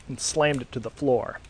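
plain reading voice

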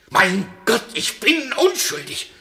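loud, high despairing outcry; desperate shout of innocence; anguished cry. Acted. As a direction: cry it out to God. Prisoner cries out in night.

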